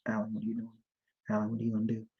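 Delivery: inner voice. Retold or imitated. Recalling his own inner voice to listeners, hushed and soft. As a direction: murmur it like the voice inside your head. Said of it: "you hear your voice talking to yourself".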